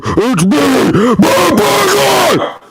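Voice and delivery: throat-straining shout; strained voice. Real, not acted. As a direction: voice straining on a high yell. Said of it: "Ow, my throat".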